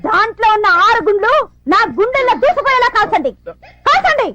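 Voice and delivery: high, melodramatic dare; defiant outburst. Acted. Wife daring husband to fire all six bullets, melodramatic and defiant. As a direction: build to a high-pitched final command.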